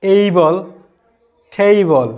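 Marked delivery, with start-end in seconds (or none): 0.0-0.7 "এই বল" with a clear "ei" start; correct "able". Shown as a demonstration. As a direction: stress the "এই" in "এই বল". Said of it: "যেমন উচ্চারণ করতে হবে". ("এই বল" স্পষ্ট করে)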